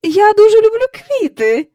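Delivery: very high, loud protest; pleading objection. A narrator's reading. Hostess defending her flowers, protesting. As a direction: stand up for it plaintively. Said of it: "заперечила місіс Бенкс".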